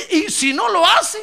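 a high, anxious, stammering question; worried doubt. Retold or imitated. Doubting companion asking the other in the furnace story, pitched high and anxious. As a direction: fret it out loud with sudden alarm. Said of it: "lo codió".

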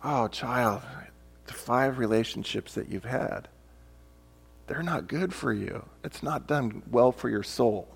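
hushed compassionate voice, low and soft; tender. Retold or imitated. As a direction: soothe her like a loving parent. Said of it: "There's just a different tone".

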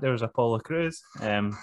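ordinary talk

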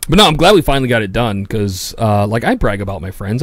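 usual speaking voice

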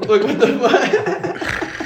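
high, loud, drawn-out disbelief through laughter; mock bewilderment. [name] reacting to being yelled at for nothing, mock bewilderment. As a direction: play the baffled victim, laughing as you stretch it.

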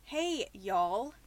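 toned-down Spokane, Washington greeting; understated Pacific Northwest accent; Washingtonian accent. Acted. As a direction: say it like a Washingtonian, no country twang. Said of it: "let me do it as a Spokenite, as a Washingtonian".

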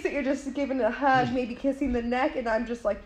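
ordinary talk, nothing marked

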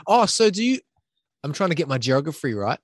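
usual speaking voice

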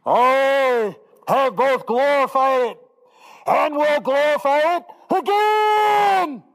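high, slow booming voice from the sky; voice from heaven. Acted. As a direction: proclaim it like God from above. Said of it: "A voice came out of heaven saying".

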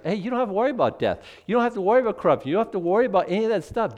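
preacher gushing reassurance; excited reassurance. Real, not acted. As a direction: rush the good news out. Exuberant reassurance to congregation.

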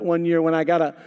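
usual speaking voice

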